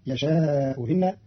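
يشاء إن with the second hamza eased, very slowly; Qalun reading. Shown as a demonstration. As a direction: soften the hamza of إن after يشاء. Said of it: "بين بين وإبدالها واوا مكسورة". (يشاء إن بتسهيل الهمزة الثانية)

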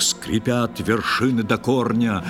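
usual speaking voice

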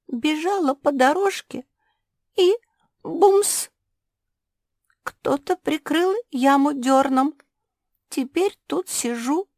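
high, pitiful tale of mishap; small high voice in trouble; trembling little voice. A narrator's reading. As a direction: tell it up high, a touch tremulous. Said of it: "дрожащим голоском продолжила".

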